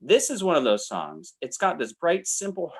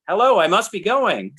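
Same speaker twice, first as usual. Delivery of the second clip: exclaimed title; 7 semitones higher, 7 dB louder than usual. Shown as a demonstration. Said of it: "with an exclamation point".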